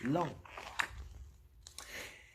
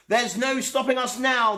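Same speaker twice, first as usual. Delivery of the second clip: triumphant; 10 semitones higher, 5 dB louder than usual. A narrator's reading. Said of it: "they said".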